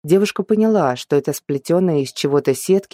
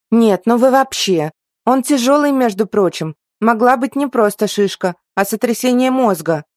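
indignant scolding; 7 semitones higher, 4 dB louder than usual. A narrator's reading. Girl scolds elves about the nut.

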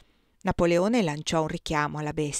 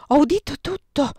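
high, loud exclamation; excited outburst; 7 semitones higher, 7 dB louder than usual. A narrator's reading. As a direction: blurt it out to your sister. Sister sits up, announces she heard. (esclamazione concitata, acuta)

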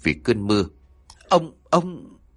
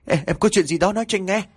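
frantic; 9 semitones higher, faster than usual. Acted. Husband frantic over terrified wife.

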